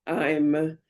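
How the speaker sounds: breaking voice on the verge of tears; voice cracking. Real, not acted. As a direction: voice cracks with emotion. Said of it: "not the voice crack".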